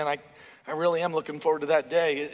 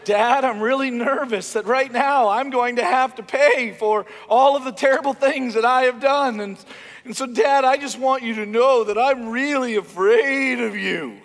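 louder mock-scared child pleading with dad; hammed-up kid plea; 4 semitones higher, 5 dB louder than usual. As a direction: overplay the fake fear, begging. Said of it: "conversations with my kids who know how to try to manipulate me".

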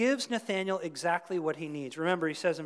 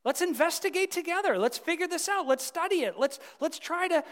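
much higher and louder, eager and inviting; eager Philip; 12 semitones higher, 8 dB louder than usual. Retold or imitated. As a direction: pull your friend along with excitement. Philip answers Nathanael's doubt.

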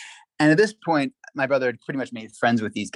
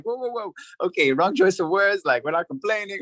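alarmed backpedal; 8 semitones higher than usual. Retold or imitated. Panicked correction.

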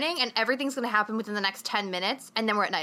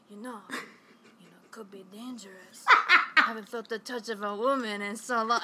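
Jon Voight impression. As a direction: murmur it in a put-on actor's accent. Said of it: "make sounds with your mouth".

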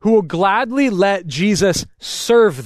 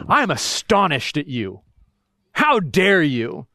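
indignant; 5 semitones lower than usual. Retold or imitated. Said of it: "you can also be".

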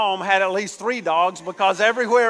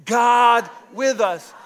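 loud, slow call to repeat; congregation call-out; 3 dB louder, much slower than usual. Real, not acted. Pastor leading the congregation in a loud call-and-response. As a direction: lead the church to shout it back. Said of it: "Say it again".

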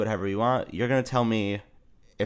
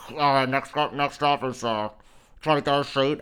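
rattly train announcement; 3 semitones higher than usual. Retold or imitated. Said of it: "all rattly and".

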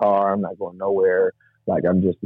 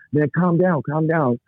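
high, insistent imitated plea to settle; urgent calming; 8 semitones higher, slower than usual. Driver to the yelling young man, urgent imitated calming. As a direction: talk someone down urgently, pitched high. Driver trying to calm him down.

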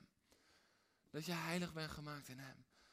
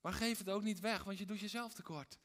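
usual speaking voice

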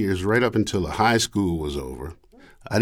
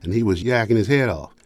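ordinary talk, nothing marked

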